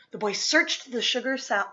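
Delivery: plain reading voice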